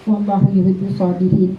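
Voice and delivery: measured Arabic recitation of the verse. Acted. Reminding teachers of the verse to hold onto, in a calm, reverent way.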